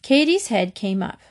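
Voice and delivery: ordinary delivery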